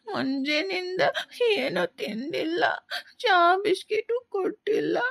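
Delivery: put-on a starving child weeping for food; crying hungry. Hungry boy begs stepmother. (ಅಳುತ್ತಾ ಹಸಿವಿನ ದೂರು)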